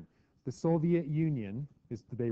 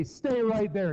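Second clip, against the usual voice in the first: higher, louder stern command; parent ordering; 7 semitones higher, 4 dB louder, slower than usual. Retold or imitated. Parent ordering a child to stay put, stern. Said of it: "Or they say".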